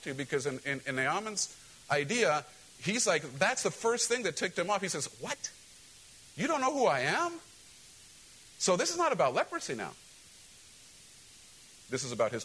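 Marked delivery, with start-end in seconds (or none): 5.2-7.3 indignant outrage. Acted. Naaman offended by the prophet.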